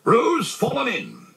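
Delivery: high, loud, drawn-out cry; high urgent shout; crying out in alarm. A narrator's reading. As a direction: raise the alarm at the top of the voice. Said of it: "cried Rabbit".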